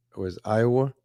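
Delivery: usual speaking voice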